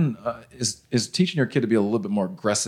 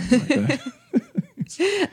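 nervous stranger; much slower than usual. Retold or imitated. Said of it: "He's like".

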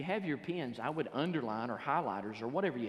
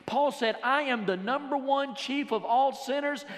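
high, loud, rising preacher's cry; preaching at full force; 6 semitones higher, 4 dB louder than usual. Acted. Preacher driving home sin to his congregation, preaching hard. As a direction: preach it hard, drawing out the words.

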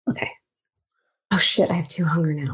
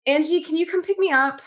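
high, urgent phone plea; acted anxious pleading; 6 semitones higher than usual. Player's character phoning a friend for a pickup, anxiously pleading. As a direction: plead high, hurrying to reassure her.

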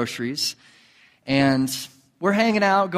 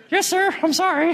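much higher, sheepish little voice; mock meek yes sir. Telling the congregation how he answered the stranger, high and sheepish. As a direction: play the scolded kid, squeaky and humble.

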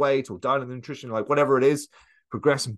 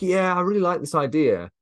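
high, breezy eager voice; eager enthusiasm; 8 semitones higher, 4 dB louder than usual. Retold or imitated. Someone dreaming up a new goal, voiced high and eager. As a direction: gush about the idea like it's brand new. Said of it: "we're kind of like".

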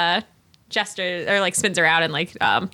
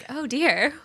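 mock prim Regency lady, a little higher; Pride and Prejudice lady; 4 semitones higher, 6 dB quieter than usual. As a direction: play the demure lady dropping her handkerchief on purpose. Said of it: "full Pride and Prejudice".

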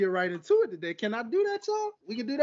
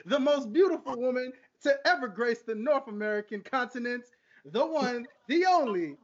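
grand hype guest introduction; ringmaster; 4 semitones higher than usual. Acted. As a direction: build it up like a ring announcer. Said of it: "check this little intro out".

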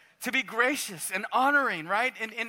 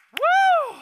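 high, loud cheer of joy; joyful whoop; 11 dB louder than usual. Real, not acted. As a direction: let out a delighted woo. Rejoicing over salvation by grace.

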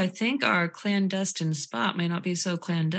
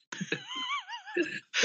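real high-pitched, soft laugh; 8 dB quieter than usual. Real, not acted. Tickled by own story about the threat, high soft laugh. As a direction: squeal softly with laughter.

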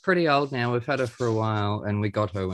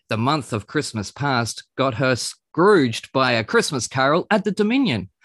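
rapid, punchy alliterative announcer patter; tongue-twisting presenter build-up, relentless pace; showbiz host intro; 5 semitones higher than usual. Acted. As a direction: sell the guests like a variety show host. Launches into a showy host intro.

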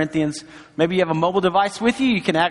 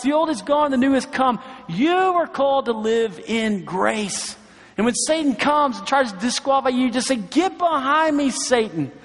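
raised-pitch, emphatic sermon exhortation; impassioned preaching; 5 semitones higher than usual. Real, not acted. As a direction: drive each declaration home, drawing out the key phrases.

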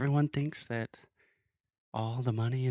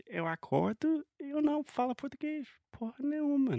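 mock despair. Mock frustration about Portuguese.

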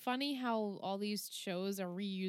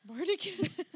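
playful nickname; 9 semitones higher than usual. Pretend.

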